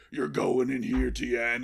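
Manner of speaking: restrained menacing wrestler threat; The Undertaker. Acted. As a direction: play the ominous half of a double act. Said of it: "and he's like".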